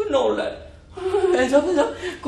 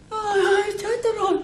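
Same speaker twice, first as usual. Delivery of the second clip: mock wail; 11 semitones higher, 5 dB quieter than usual. Master wails at his ruin.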